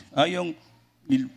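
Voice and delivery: ordinary talk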